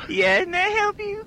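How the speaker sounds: very high squeaky falsetto [name]; shrieking falsetto pop diva. Acted. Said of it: "[name] is the store owner".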